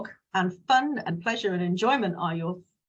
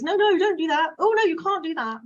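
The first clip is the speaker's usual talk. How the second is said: high, loud nagging parent voice; scolding parent; 9 semitones higher, 5 dB louder than usual. Acted. Parent forbidding a child everything, high scolding nag. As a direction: wag a finger at a kid, no no no.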